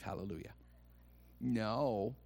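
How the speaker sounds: ordinary talk, nothing marked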